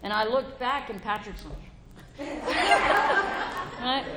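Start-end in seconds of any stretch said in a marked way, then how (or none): 2.2-3.8 a high breathy burst of laughter. Real, not acted. Sharing the proposal story, laughing out high. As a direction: laugh at the memory of his proposal.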